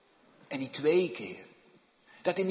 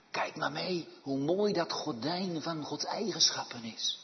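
ordinary talk

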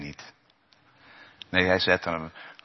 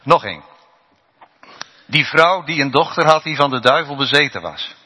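ordinary talk

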